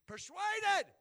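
loud, high cry on one word; emphatic preaching shout. Real, not acted. Preacher driving home Rahab's faith, emphatic shout. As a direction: burst out with the key word.

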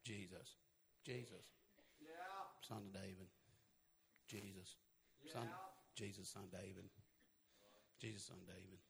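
hushed, meek, far-away calling of the name; timid murmured plea. Retold or imitated. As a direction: say it like someone who doesn't dare call out. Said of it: "He wasn't sitting up there saying".

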